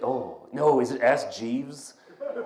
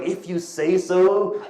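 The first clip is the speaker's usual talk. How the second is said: mock-indignant repeat, higher and slow; outraged echo; 6 semitones higher, slower than usual. Shocked friend throwing his remark back at him, mock outrage. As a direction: drag it out high in shocked disbelief. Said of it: "like, furious. Her friend was like".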